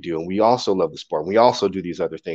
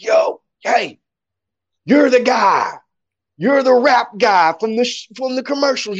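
put-on high, excited drunken shout; drunk fan; 13 semitones higher, 3 dB louder than usual. Drunk fan recognizing the poet from commercials, high excited holler. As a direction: imitate a drunk who just recognized someone. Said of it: "he's drunk. I know he's drunk".

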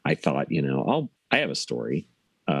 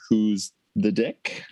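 segment title; 3 semitones higher, much slower than usual. Acted. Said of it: "go into the next segment here".

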